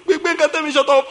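lightly voiced tearful girl; mock crying child. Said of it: "was crying seriously".

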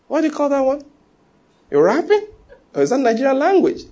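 high-pitched, incredulous questioning; puzzled questioning. Retold or imitated. Americans query a Nigerian newcomer's rapping, high puzzled questioning.

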